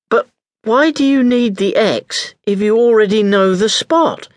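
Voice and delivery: put-on high, puzzled young girl's voice; curious girl. A narrator's reading. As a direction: ask it high and wondering, like a sharp child.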